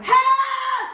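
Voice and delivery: a high, loud panicked scream of "Help!". Acted. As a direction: yell for help as if in danger.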